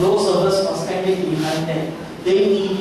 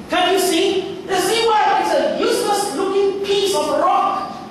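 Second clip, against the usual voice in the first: high, excited storytelling voices; high-pitched quarrel over the rock; acted dialogue; 13 semitones higher than usual. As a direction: argue it out in voices.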